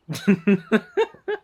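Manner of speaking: high burst of laughter. Real, not acted. Laughs after jumping off roof.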